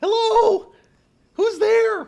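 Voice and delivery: very high, loud squeaky puppet. Pretend. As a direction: call out like a silly puppet. Adult starting puppet voice.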